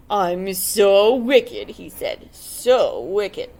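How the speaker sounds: slow, loud, put-on wolf voice; gloating wolf. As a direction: gloat over your own wickedness. The wolf speaks.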